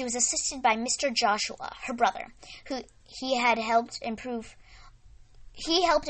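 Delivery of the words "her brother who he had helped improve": usual speaking voice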